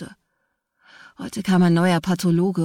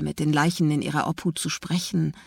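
ordinary delivery

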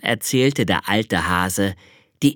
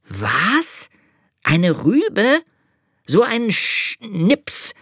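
higher, outraged disbelief, last words drawn out slowly; astonished cry; 12 semitones higher, 3 dB louder, much slower than usual. Acted. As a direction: angel scoffing at the carrot idea. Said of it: "rief der kleine Engel".

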